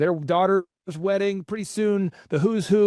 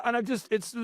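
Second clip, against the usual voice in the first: ordinary delivery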